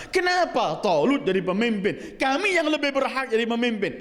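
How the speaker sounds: high-pitched mock outcry; indignant protest. Israelites objecting to Talut as leader, acted indignant protest. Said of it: "mereka teriak".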